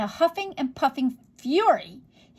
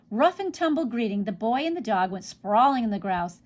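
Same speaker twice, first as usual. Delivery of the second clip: ordinary delivery, nothing marked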